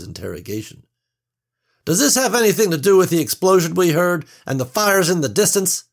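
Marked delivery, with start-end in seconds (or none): 1.9-5.8 loud, high, pushy questioning; demanding portly man. A narrator's reading. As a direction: bluster at the stewardess. Portly man interrogates stewardess.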